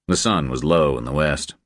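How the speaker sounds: plain reading voice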